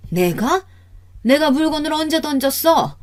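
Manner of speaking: high-pitched, affronted question; indignant denial. Acted. Mother-in-law denying she threw things, indignant denial. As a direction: deny it in high-pitched protest.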